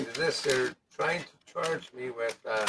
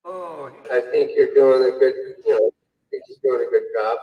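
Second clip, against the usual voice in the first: loud echoing pipe-like sound; through a tube; 10 dB louder than usual. Real, not acted. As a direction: voice distorted as if inside a pipe. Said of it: "Sounds like you're in a pipe".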